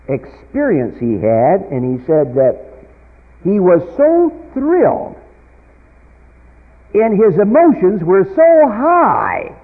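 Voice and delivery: loud, ringing pulpit delivery building to peaks; preaching at full force. Acted. Preacher telling his congregation a conversion story, preaching at full force. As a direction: preach it out to the back pews.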